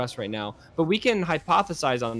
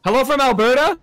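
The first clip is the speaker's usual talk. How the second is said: louder, higher greeting; excited announcement; 9 semitones higher, 9 dB louder than usual. Real, not acted. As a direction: call it out with a burst of energy. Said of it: "reads viewer greeting out".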